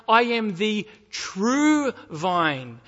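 slow emphatic "true" in "the true vine"; stress on TRUE. Shown as a demonstration. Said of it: "why emphasize that you're the true vine".

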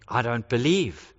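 a doubter closing the door; stubborn Thomas. Retold or imitated. Thomas refusing the disciples, low and stubborn. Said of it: "says Thomas".

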